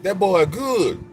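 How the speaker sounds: drawn out, high, savoring each word; slow, high line from Coming to America; slow movie catchphrase. Retold or imitated. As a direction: stretch the catchphrase slowly. Quoting movie line again.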